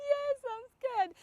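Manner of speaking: high, loud mock wail; crying voice. Scared walker answering the officer, mock crying voice. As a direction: blurt it out as an imitated tearful bawl. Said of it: "Like the same voice when I'm crying".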